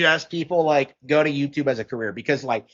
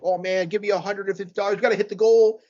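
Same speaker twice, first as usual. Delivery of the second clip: mock begging streamer; 5 semitones higher, faster than usual. Voices money-begging streamers.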